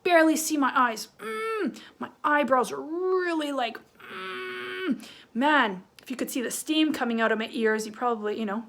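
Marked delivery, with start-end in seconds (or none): 1.2-1.8 long, high angry "mmm"; angry hum. Shown as a demonstration. Teacher showing kids a small angry mouth, angry hum. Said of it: "Now, if I'm angry". 4.0-5.0 soft, high-pitched angry "mm"; angry hum. Shown as a demonstration. Teacher showing kids angry eyebrows, angry hum. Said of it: "Now, if I'm angry".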